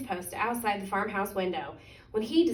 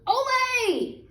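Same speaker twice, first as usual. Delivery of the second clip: very high, loud quacky Ole; high duck chorus cheer; 5 dB louder than usual. A narrator's reading. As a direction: shout it like a flock of happy ducks. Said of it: "and the ducks said".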